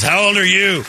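put-on high, loud slurred drunk question; drunk guy voice. Drunk man at the bar asks his age, high and loud. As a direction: ham up a wasted stranger.

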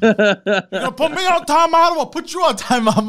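loud, very high-pitched comic outburst; mock defiant yell. Friend playing a defiant kid to cops, mock yelling. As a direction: shoot the pitch way up in pretend outrage. Mocks cops treated as kids.

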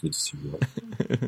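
high, soft dubbed governor voice; hidden voice double. Pretend. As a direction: pretend to dub him from under the podium. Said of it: "under the podium with the mic".

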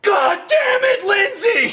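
very high, loud, slow mock outburst; imitating an annoyed friend yelling at Lindsay. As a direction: scream her name in exasperation. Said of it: "He was, like, so annoyed".

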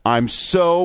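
"so" stretched out, pitched high; drawn-out SO. Acted. Host reading coach's gushing emailed speech, "so" drawn out. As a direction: hold the vowel in "so" long and high. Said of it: "with four O's".

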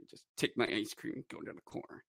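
whispered, defeated aside; sulky whisper. Retold or imitated. Bitter fan grabbing his ice cream, whispered. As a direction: mumble it off to the corner.

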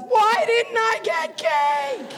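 put-on high, slow whining child laughing through it; whiny kid. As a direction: whine like a kid denied cake. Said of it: "four hours of".

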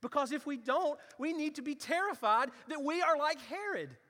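rising sermon fervour; fiery preaching. Acted. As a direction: preach it hard to the congregation. Preaching at full force.

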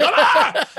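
high, loud cry of exasperation; mock scream at the end of your rope; hammed-up frustrated yell; acted frustrated scream. Acts out losing patience.

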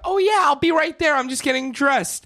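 high, loud call from another room; screamed out. Retold or imitated. Retelling someone screaming out to a visitor, loud holler. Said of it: "like, detective screams out".

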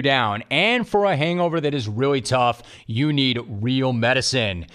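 upbeat, steady sponsor pitch; ad read. Acted. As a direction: sell it like a radio spot. Host reads sponsor ad.

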